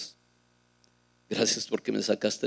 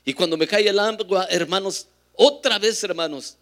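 loud, raised proclamation; full-force preaching; 6 semitones higher, 8 dB louder than usual. Acted. Preacher tells the brothers about tongues in the shower, loud and raised. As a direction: lift the voice to the congregation again.

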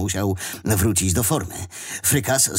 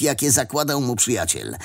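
ordinary delivery

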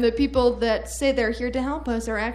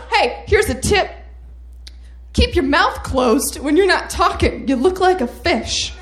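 louder, higher mock-gruff senator voice; mock barked warning; 6 semitones higher, 5 dB louder than usual. Senator snapping at aide over bread plan, loud mock-gruff. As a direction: snap it at him like a scolding boss.

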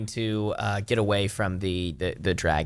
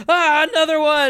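high, loud panicked shriek; terrified scream; 5 dB louder than usual. Acted. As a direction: shriek as another dragon lands. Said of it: "they're like".

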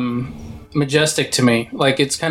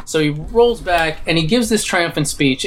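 usual speaking voice

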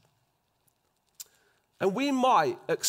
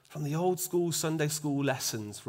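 usual speaking voice

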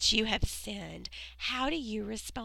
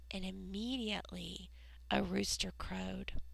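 whisper; 4 semitones lower, 7 dB quieter than usual. A narrator's reading. Dramatic moment of denial.

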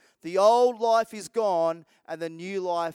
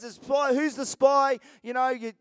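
ordinary talk

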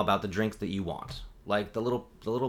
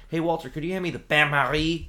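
"bain marie" in a hammed-up French accent, louder; put-on French pronunciation; 7 semitones higher, 5 dB louder than usual. Culinary classmate asking for a pan, mock French accent. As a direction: ask normally, then go very French on "bain marie". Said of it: "no accent".

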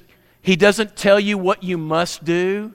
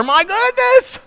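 mock astonishment; slower than usual. Real, not acted.

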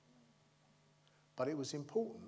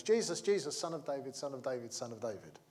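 usual speaking voice

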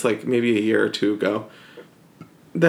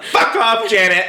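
louder playful mock rebuke, higher; mock snap; 9 semitones higher, 4 dB louder than usual. Host mock-scolding co-host after her hair complaint, playful louder snap. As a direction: bark it out as a joke.

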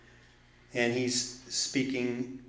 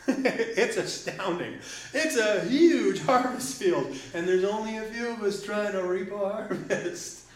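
laughing amazement; 7 semitones higher than usual. Real, not acted. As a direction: marvel with a real chuckle. Laughs while marveling at few workers.

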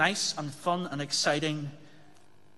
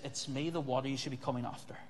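softer, fast and intimate; Jesus gently telling the crowd, softer and faster; quiet Jesus; 3 semitones lower, 7 dB quieter, faster than usual. Acted. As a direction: lean in and say it softly. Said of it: "Jesus says".

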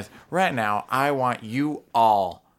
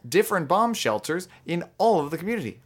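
high, loud cheery praise; enthusiastic; 7 semitones higher, 4 dB louder than usual. Acted. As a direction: gush over the kids. Counselor praising kids.

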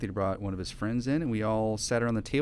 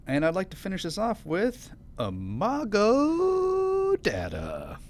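big showman announcer voice, slow; ringmaster; 10 semitones higher, much slower than usual. Acted. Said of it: "circus ringmaster voice".

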